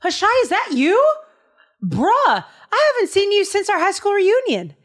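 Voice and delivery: put-on bright high surprised hello to an old friend; David spotting his old friend, delighted call; excited greeting. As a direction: greet a long-lost buddy with delight. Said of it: "and he goes".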